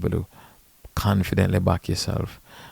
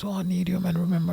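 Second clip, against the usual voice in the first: high-pitched mock plea; imitated pleading player; 11 semitones higher, faster than usual. Player wheedling a caddy who is already assigned, high mock plea. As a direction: mimic a needy player in a much higher voice. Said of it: "This fucker comes".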